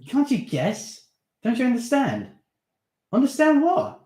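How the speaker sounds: excited. Acted. Excited exchange between boys.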